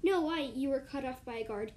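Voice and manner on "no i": 1 urgent protest. Acted. Protest cut off by guard.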